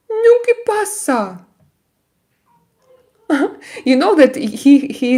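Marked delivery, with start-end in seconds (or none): 0.1-3.6 very high pet-talk squeak; cooing to cat. Real, not acted. Streamer pressing her cat for an answer, very high cooing. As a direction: coo it far up high.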